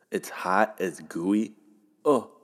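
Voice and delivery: usual speaking voice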